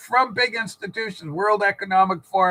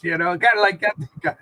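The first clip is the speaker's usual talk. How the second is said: ordinary delivery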